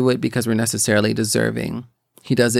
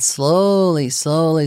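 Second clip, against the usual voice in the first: drawn-out slowly; 3 semitones higher, much slower than usual. Acted. Telling how the anger slowly unlocked, slow drawn-out words. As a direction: stretch each slowly out, very slow.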